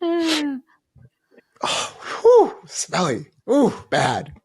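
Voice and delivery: high mock gag at a smell; mock disgust. He mocks the doomsayers' afterlife as a stench, in hammed-up disgust. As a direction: react to an imagined stench, hammed up.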